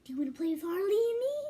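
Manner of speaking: creepy whisper building to a loud, fast taunt; creepy villain voice. Pretend. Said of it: "You're creepy".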